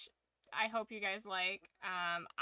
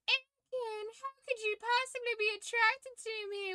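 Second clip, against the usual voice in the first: high-pitched mock Southern Belle; hammed-up Southern Belle voice; 12 semitones higher than usual. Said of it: "I made her a Southern Belle".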